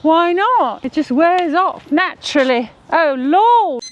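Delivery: high, lilting mock-British; put-on British accent. Wife defending her accent to husband, put-on British accent. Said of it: "talk to her in British".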